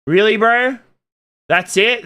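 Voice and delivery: high, loud disbelief; really, bro? disbelieving; incredulous scoff. Real, not acted. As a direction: scoff at fish and chips, pitch up and loud. Mocking British cuisine claim.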